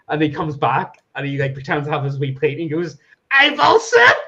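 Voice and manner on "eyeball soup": high, loud, laughing imitation of a child; mock gleeful shriek. Son playing waiter springs his joke dish on dad, gleeful mock shriek. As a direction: shriek it with impish glee.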